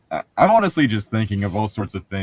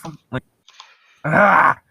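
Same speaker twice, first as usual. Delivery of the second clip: disgusted groan; 6 semitones higher, slower than usual. Real, not acted. Groaning at the book.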